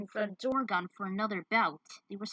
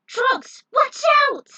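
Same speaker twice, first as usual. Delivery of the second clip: high, loud yell of alarm; warning scream; 15 dB louder, slower than usual. Acted. Hero warning Trunks of the attack, high panicked scream. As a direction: scream the warning across the field.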